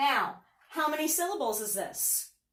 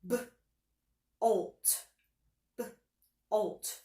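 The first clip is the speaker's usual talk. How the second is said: B, then olt, split apart; sounded out. Shown as a demonstration. Teacher tapping out bolt for the class. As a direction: tap out the two parts. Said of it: "Let's tap that one out".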